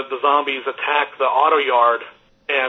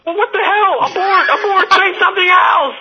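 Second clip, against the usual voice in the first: high, loud, hammed-up panic; panicked inner scream; 6 dB louder than usual. Fan screaming at himself to abort, panicked inner scream. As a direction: yell at yourself like a comic emergency alarm. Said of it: "in my head just screaming".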